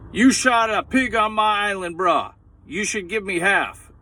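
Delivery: high, loud Hawaiian pidgin voice; a hint of an island bruh; Hawaiian local. Hawaiian guy demanding half the pig, mock pidgin. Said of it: "So he says".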